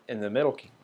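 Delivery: ordinary talk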